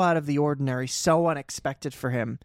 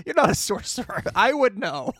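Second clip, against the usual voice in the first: higher, laughing scoff as Arthur; mock dismissive Arthur; 6 semitones higher than usual. Podcaster quoting Arthur scoffing at Merlin, laughing. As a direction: brush it off with a laugh.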